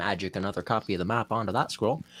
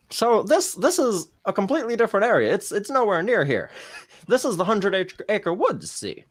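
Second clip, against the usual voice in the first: higher-pitched, fussy game character voice; eager guide, higher voice; flustered helpful guide character; 10 semitones higher, 4 dB louder than usual. Acted. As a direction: hurry in to correct them, pitched up. Game master plays a character.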